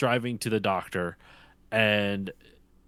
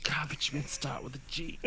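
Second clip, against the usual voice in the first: softer mock exasperated grumble; 3 semitones higher, 10 dB quieter than usual. Quoting the line he thinks when fed up, exasperated. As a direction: lightly imitate a fed-up dad. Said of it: "just so exasperated".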